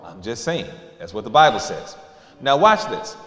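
normal talking voice

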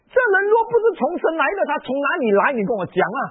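very high, loud, near-crying cry; tearful pulpit climax; impassioned preaching. Acted. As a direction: plead it out at full force.

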